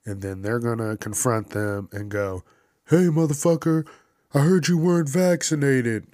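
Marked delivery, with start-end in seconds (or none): 2.9-6.1 loud, higher accusing call; confronting. Retold or imitated. Festivalgoer confronting an unvaccinated stranger, loud and accusing. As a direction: get in his face and call him out.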